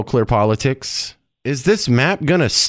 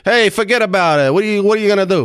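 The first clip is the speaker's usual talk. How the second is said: higher-pitched mock New Jersey accent; 7 semitones higher, faster than usual. Host teases guest about his home state, New Jersey accent. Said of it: "to sound more like New Jersey".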